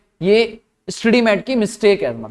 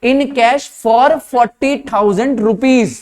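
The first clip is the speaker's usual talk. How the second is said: "for forty thousand rupees" spelled out slowly, word by word; deliberate, emphatic read-out; careful reading; 3 semitones higher, much slower than usual. Shown as a demonstration. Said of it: "line का मतलब अगर ध्यान से देखो".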